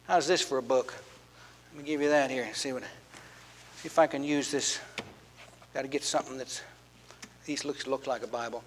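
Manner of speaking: low, soft aside to himself; half under his breath while searching; muttered aside. Real, not acted. Preacher mutters while hunting for a book.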